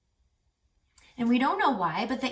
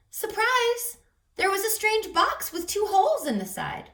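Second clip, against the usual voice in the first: high, bright surprise reveal; surprised; 10 semitones higher, 4 dB louder than usual. A narrator's reading. Reading teacher reveals the strange box, surprised. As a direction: gasp out the surprise to the kids. Story twist, surprise announced.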